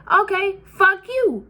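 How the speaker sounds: high, slow, drawn-out talk-back; defiant retort. Retold or imitated. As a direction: fire it back at grandma. Arguing back at grandma.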